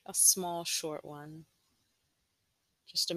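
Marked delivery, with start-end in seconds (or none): none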